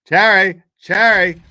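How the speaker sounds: loud, high call of the name Jerry; mock shouting Jerry. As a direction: yell for Jerry as if across a room. Calling out to Jerry.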